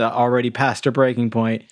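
ordinary talk

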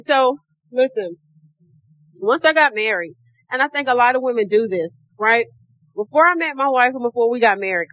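ordinary talk, nothing marked

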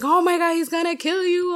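high, loud mock panic; alarmed cousin. His cousin warning her about the episode, mock high panic. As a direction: squeal it like a shocked cousin.

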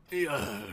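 soft pained creature groan; hurt creature groan. Acted. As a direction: groan weakly like a wounded beast. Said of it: "He's got, like, a hurt condition".

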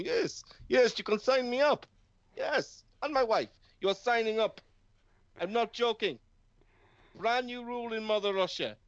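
playing Putin in broken English; Russian accent. Voicing Putin, Mother Russia.

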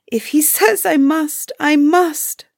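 high, plaintive storybook sob; sobbed. A narrator's reading. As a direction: plead it high, as if in tears. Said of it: "poor Ilona sobbed".

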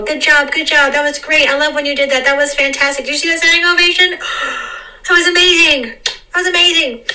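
cast party cheer. Retold or imitated. Cast celebrating after the show, gushing excitedly. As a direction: hype up your castmates after the show. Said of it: "They go".

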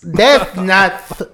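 sassy, punched mock translation; mimicking Siri's attitude. Player mocking Siri's spicy translation, sassy imitation. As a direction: copy the assistant with exaggerated attitude. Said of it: "say it with so much attitude".

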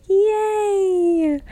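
high squealed yay; excited yay. Retold or imitated. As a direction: squeal it with delight. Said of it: "And I was like".

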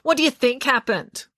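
blocked nose, scornful retort; sarcastic nasal voice. Acted. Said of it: "she said sarcastically".

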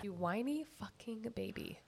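very soft teasing insult; hushed mock put-down; muttered jab. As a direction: mutter it under your breath. Muttered jab back at co-host.

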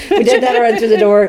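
laughing. Real, not acted. Laughing clarification.

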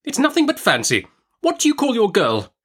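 very high, dismissive aunt; brusque Miss Betsy. A narrator's reading. Aunt dismisses mother's fancy and asks after servant, brusque. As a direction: wave the fears away, pitched high.

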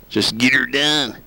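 put-on country comedian voice; Larry the Cable Guy. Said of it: "The Larry the Cable Guy saying".

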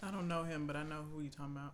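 talking through a mouthful; chewing while talking. Real, not acted. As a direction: mouth full of food. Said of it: "Sorry, I'm chewing".

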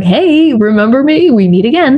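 a hint of a sly, taunting tormentor; taunting inner voice. Eating disorder voice taunting her at family meals, mild mock sly greeting. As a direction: greet her like an old enemy. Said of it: "the eating disorder voice starts to rear its ugly head".

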